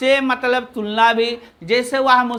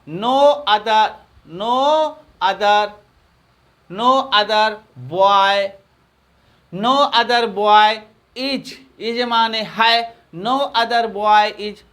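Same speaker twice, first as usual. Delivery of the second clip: slow dictation; much slower than usual. Shown as a demonstration. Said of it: "teacher dictates a sentence slowly".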